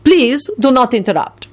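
put-on high, imperious accented rebuke; lofty. A narrator's reading. Radu silences Lupin mid-story, loftily. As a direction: wave off the interruption from on high. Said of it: "Radu said loftily".

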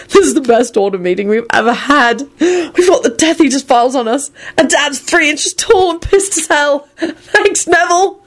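high-pitched, nearly tearful hilarity; wheezing glee. A narrator's reading. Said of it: "George wheezed".

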